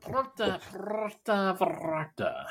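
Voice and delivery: Puerto said with too many R's, as "Porto Vallarta"; high, drawn-out Puerto with heavy R's; mispronounced Puerto. Real, not acted. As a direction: roll extra R's through Puerto. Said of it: "That may be the worst pronunciation of Puerto".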